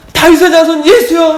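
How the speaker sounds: high, very loud desperate call; shouting out. Acted. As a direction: belt it out to be heard over the crowd. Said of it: "막 소리를 지르는 거예요". (목청껏 소리 지르듯이)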